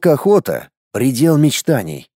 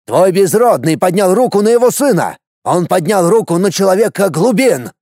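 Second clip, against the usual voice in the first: a furious warrior yelling, pitched up; angry yell; 5 semitones higher than usual. Acted. Warrior yells at the mother over his nephew, in fury. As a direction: roar it out with rage. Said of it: "со злобой заорал".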